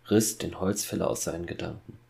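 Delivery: ordinary delivery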